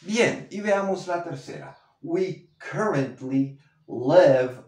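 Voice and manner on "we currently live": live said short, like lev, slowly; live como lev. Shown as a demonstration. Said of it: "not live".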